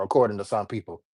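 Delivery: ordinary delivery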